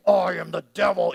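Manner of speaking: creepy devil voice. Retold or imitated. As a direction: ham up the Hollywood devil, lightly. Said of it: "give it the creepy voice".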